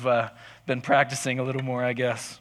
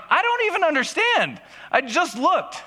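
high-pitched, incredulous squeal; hammed-up bewildered whine; baffled protest; 14 semitones higher than usual. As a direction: protest in disbelief, pitch shooting right up. Acted baffled protest on being caught.

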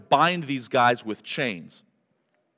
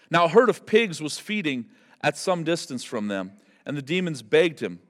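usual speaking voice

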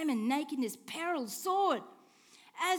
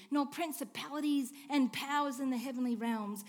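normal talking voice

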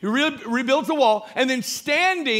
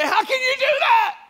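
mock objector; 12 semitones higher than usual. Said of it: "You say".

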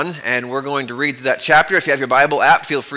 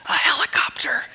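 voiceless, softer hushed whisper; child's whisper; 6 dB quieter than usual. Acted. Child whispering to the boss about the noise. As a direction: breathe it out secretly. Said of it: "answered the whispering voice".

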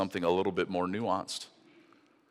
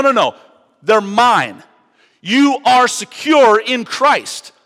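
much higher and much louder impassioned sermon; full-force preaching; 11 semitones higher, 13 dB louder than usual. Acted. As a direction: preach it with everything, hitting both barrels.

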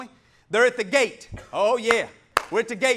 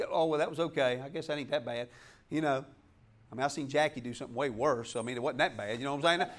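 much softer, lower, fast shrugging mock voice; excusing sin; 12 semitones lower, 8 dB quieter, faster than usual. Preacher mocks a believer excusing his sin, soft and offhand. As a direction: brush off your sin with a lazy excuse. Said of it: "I don't sit there and".

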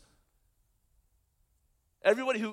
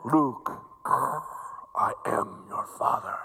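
heavy mechanical breaths between whispered, ominous words; Darth Vader; 7 semitones lower than usual. Acted. Villain revealing himself as Luke's father, menacing breathy whisper. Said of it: "from Darth Vader, the, the greatest villain".

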